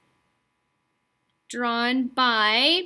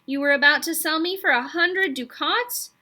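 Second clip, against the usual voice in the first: angry; 6 semitones higher, 5 dB louder than usual. A narrator's reading. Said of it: "getting angry".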